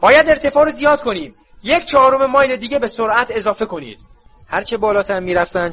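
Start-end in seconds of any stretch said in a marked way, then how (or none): 0.0-4.0 put-on higher, louder commanding voice of a tough old auror; gruff old auror barking orders; Moody giving orders. A narrator's reading. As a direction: bark the flight orders over the wind.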